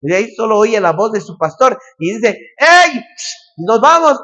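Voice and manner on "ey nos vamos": shepherd calling out. Retold or imitated. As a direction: call the sheep home across the field. Shepherd calls his sheep.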